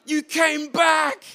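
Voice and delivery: very high, loud, slow joyful shout; overjoyed cry. Retold or imitated. Author greeting returning fans, ecstatic loud high shout. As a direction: call it out like a long-lost reunion. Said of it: "And I'm just going".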